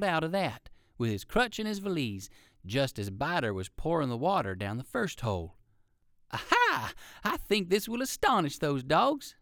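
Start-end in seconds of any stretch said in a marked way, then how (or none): 6.3-9.4 very high Aha, then sly pleased voice; rabbit gloating. A narrator's reading. Uncle Wiggily pleased to fool the dogs, gleeful. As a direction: crow with delight at outwitting them. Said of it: "thought Uncle Wiggily".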